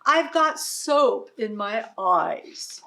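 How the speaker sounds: slow, loud complaining cow; a cow grumbling through soapy eyes; mildly voiced cow complaint; whiny cow. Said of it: "cried the cow".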